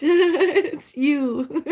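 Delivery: bursts out laughing on "it's you". Real, not acted. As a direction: laugh through the words, high and loud. Laughing at a viewer's comment.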